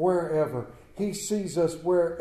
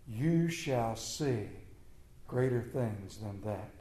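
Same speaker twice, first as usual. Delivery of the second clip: scripture reading; 4 semitones lower than usual. Acted. Reads the Gospel passage aloud.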